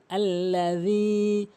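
"alla-dheee", final vowel held; slow আল্লাযী with th-like z; correct dhal. Shown as a demonstration. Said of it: "তিন আলিফ টানবেন".

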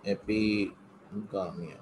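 Epigamia drawn out slowly and softly; slow and careful. Shown as a demonstration. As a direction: say Epigamia syllable by syllable, quietly. Teacher repeats name for notes.

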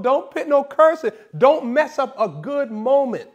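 ordinary talk

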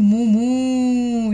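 cow mooing. Shown as a demonstration. Teacher showing children the puppet cow's sound, cow mooing. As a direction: moo like a cow, stretching the vowels. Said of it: "it's going to give a sound".

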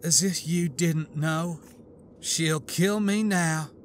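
slow, fading resignation; weary. A narrator's reading. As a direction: let the words sink, giving up. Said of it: "she said wearily".

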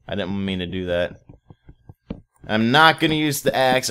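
ordinary talk

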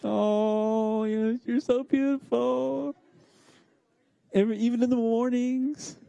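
high, slow mock-tearful gushing; hammed-up lovesick weeping. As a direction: pretend to weep with adoration in a high, strained voice. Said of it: "I just start weeping".

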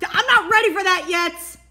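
loud frantic protest; panicked yell. Acted. Panicked kaiju yells.

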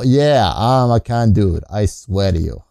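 usual speaking voice

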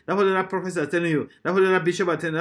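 ordinary talk

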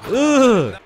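high, loud satisfied grunt; celebration grunt; hype grunt. Real, not acted. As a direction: grunt with glee. Celebrating a smooth shot.

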